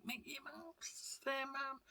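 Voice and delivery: high, very soft stretched-out squeal; hushed child's awe. Retold or imitated. Child spotting Mickey to mum, hushed excitement. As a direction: re-enact a breathless, whispery thrill.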